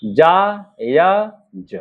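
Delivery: very slow जायज, split जा... य... ज; drawn-out dictation of जायज with gaps; syllable by syllable. Shown as a demonstration. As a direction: sound out जायज piece by piece. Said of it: "देखिए जा का आंकड़ा लगाएंगे".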